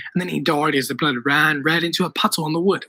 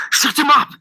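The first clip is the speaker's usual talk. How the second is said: loud, high urgent order; yelling; 8 semitones higher, 5 dB louder than usual. A narrator's reading. Tim orders friends to silence the man, yelling. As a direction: yell it to silence someone. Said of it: "Tim yelled".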